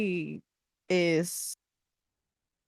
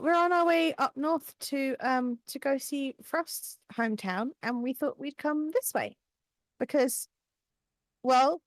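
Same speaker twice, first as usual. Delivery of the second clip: higher-pitched, hesitant explanation; Pearl, slightly sheepish; 7 semitones higher than usual. Acted. Pearl tells the mayor why she came home, hesitant. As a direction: explain yourself haltingly in a raised character voice. Player voices Pearl in dialogue.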